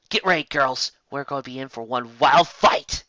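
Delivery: put-on rally the girls; battle call.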